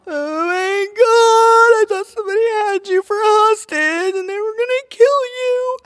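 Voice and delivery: very high, loud hammed-up wail; hysterical crying. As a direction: wail to your son in mock hysterics. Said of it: "and now I'm crying".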